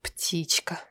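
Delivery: bitter whisper of птичка. A narrator's reading. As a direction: breathe "Птичка" as a scornful whisper. Echoes the nickname scornfully.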